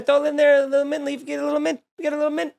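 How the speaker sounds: high-pitched, playful run of chatter; playful sing-song patter. Pretend. Host showing off a fancy garnish step, playful high patter. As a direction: toss it off in a high, silly lilt.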